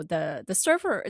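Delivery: ordinary delivery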